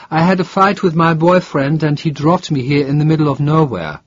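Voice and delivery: a hint of a stranded young woman. A narrator's reading. Hitchhiker Karen explains.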